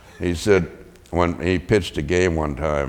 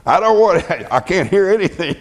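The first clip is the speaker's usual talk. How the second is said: higher, louder, laughing through it; mock deaf coach; 12 semitones higher, 6 dB louder than usual. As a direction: fake not hearing, amused. Coach pretending not to hear.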